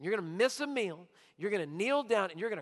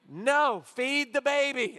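ordinary delivery

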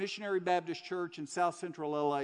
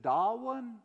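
put-on soft Southern accent; 3 semitones lower than usual. Church lady to a prisoner in his cell, soft Southern accent. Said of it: "in her soft Southern accent".

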